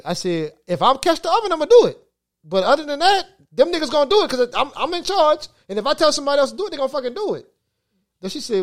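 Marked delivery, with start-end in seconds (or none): 0.7-7.5 raised, high-pitched retort to the customer; fired-up telling off of a pushy customer; heated retold rant. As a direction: push it up high and loud, laying down the law.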